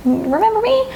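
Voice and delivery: put-on a shy little child popping its head out; timid inner child. Reader voicing the client's inner child, a small high childlike call. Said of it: "this inner child, this warmth peeks out".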